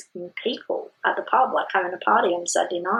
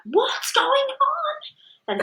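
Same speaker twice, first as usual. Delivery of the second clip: high, stretched-out mock midwife; imitating a baffled midwife, pitched way up and slowed; mock midwife squeal; much slower than usual. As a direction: draw out a squeaky startled question. Midwives react, mimicked.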